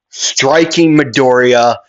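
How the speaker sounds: ordinary delivery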